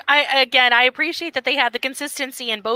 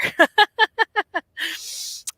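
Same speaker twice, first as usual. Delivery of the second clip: a higher falling laugh; real laugh at the end; light laugh; 8 semitones higher than usual. Real, not acted. Host laughs while wrapping up.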